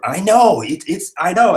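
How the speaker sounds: high loud I know; excited. Real, not acted. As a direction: gush in agreement. Excited agreeing with chat.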